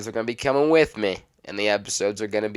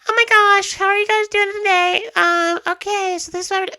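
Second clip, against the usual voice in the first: mock chipmunk voice, much higher and louder; voice changer voice; 9 dB louder than usual. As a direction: imitate a TikTok voice filter, squeaky and high. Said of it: "his voice will be changed".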